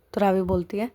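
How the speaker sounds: usual speaking voice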